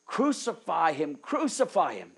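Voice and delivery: loud shouted demand; crowd shouting. Retold or imitated. Preacher voicing the crowd demanding crucifixion, loud shout. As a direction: shout it like an angry mob. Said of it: "but they shouted".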